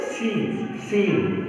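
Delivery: सीन with a drawn-out long ee, falling softly; long ee demo. Shown as a demonstration. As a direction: stretch the ee in सीन for the students. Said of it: "सौ के बाद में बड़ी ई आती है". (सीन में बड़ी ई खींचकर बोलना)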